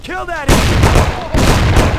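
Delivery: slow, loud stretched-out yell of Kill; drawn-out yelled order. Acted. Boss screaming at his men to kill the intruder, slow and furious. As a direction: drag the order out at full volume.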